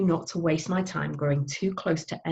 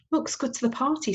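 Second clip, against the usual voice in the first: Mum; 8 semitones higher than usual. Acted. Mum quoted boasting about votes.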